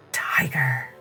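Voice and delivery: ordinary delivery, nothing marked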